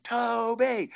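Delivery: high drawn-out crowd call; mock crowd cheering a name; cheering troops. Troops cheering the singer at the range, imitated. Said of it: "the troops are like".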